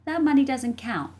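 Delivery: put-on high child voice, insisting; insistent little sister. A narrator's reading. Jessie setting bet terms with brother, insistent. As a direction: rule it out as the kid sister.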